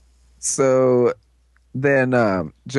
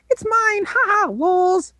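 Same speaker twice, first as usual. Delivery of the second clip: very high gloating squeal; Navi. Retold or imitated. Storyteller as the thief catching the ammo, high mock gloating. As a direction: squeak it triumphantly, stretching the end.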